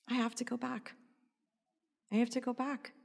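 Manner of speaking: reenacted quiet awe; hushed realization. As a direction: let it sink in, quietly. Retold realization after the angry call.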